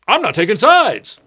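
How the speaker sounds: high, loud attention-seeker's call; mock high shout. Mimicking a neutral person who jumps in, high mock shout.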